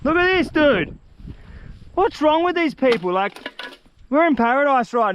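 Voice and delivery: high, disbelieving rant; real disgust at the litter; outraged. Real, not acted. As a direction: let the outrage climb high. Angry about rubbish left behind.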